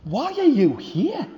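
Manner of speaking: put-on little boy to the lost bully. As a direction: ask shyly, like a small child.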